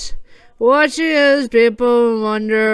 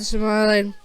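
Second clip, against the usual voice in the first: plain reading voice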